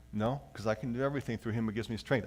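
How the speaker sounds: usual speaking voice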